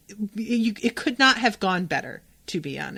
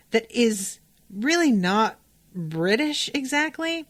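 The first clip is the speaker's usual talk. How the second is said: ordinary talk, nothing marked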